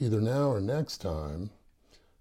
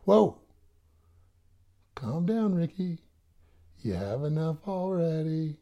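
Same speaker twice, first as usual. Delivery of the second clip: much higher, louder scolding granny; mock grandma calling out, higher; 8 semitones higher, 4 dB louder than usual. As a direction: play a fussing grandma reining him in. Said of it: "Okay, Grandma".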